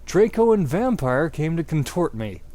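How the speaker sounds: ordinary delivery, nothing marked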